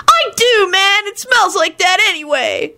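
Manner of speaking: high-pitched, whiny fury; angry outburst. A narrator's reading. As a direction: protest in an outraged high voice. Brother protests in quarrel.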